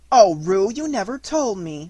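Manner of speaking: slow, gentle mother's reproach; reproachful. A narrator's reading. Kanga scolding Roo for hiding a cough, reproachful. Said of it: "said Kanga reproachfully".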